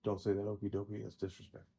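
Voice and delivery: low, quiet mock reproof; offended customer. Offended customer to the clerk, low and quiet. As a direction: object in a low, subdued grumble.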